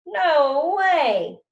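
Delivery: loud hollered protest; hollering. Acted. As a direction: holler it across the room. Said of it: "Nick was hollering".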